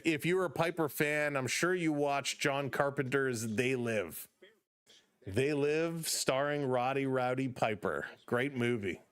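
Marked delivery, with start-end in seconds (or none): none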